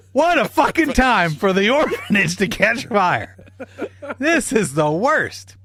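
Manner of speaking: high, slow, laughing exasperation; can barely get it out for laughing; laughing through it. Retold or imitated.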